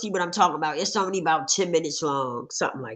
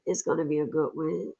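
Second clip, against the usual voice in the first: usual speaking voice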